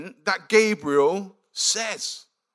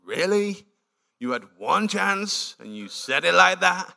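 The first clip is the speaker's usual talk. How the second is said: unimpressed. Retold or imitated. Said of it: "she's really unimpressed".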